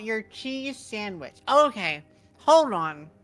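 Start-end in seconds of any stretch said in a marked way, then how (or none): 1.5-3.1 loud, raised voice to interrupt. Real, not acted. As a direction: take the floor loudly. Loudly demanding attention.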